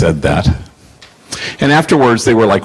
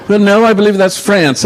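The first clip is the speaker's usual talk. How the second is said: much higher, polite correcting voice; Nikki; 12 semitones higher than usual. Retold or imitated. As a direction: gently set him straight, lifted high. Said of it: "And she goes".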